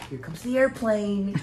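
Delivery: slow singsong feeding voice; feed it like to a toddler; airplane spoon game. Pretend. Feeding chip like a child.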